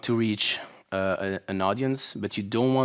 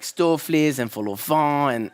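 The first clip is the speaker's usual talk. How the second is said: ordinary talk, nothing marked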